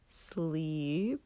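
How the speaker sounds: soft mock-innocent taunt. Katsuki teasing a blushing Shoto, quiet taunt. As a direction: tease him with a quiet knowing question. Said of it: "he taunted".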